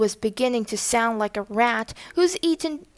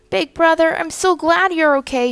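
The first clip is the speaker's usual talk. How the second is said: higher, louder, relieved and glad; sister's relief bursting out; relieved sigh; 6 semitones higher, 5 dB louder than usual. A narrator's reading. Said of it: "she sighed".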